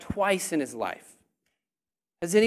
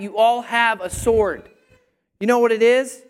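loud, emphatic preaching; yelling; 2 semitones higher, 6 dB louder than usual. Real, not acted. Preacher tells congregation they all have a sword, yelling. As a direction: drive it home at raised volume. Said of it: "Man, am I yelling too loud?".

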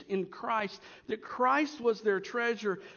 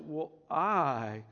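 slow, lower, mock-pious claim; a hint of holier-than-thou; lightly voiced super-spiritual believer; 6 semitones lower, much slower than usual. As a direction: draw out "I", then drop low and slow. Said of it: "the super spiritual".

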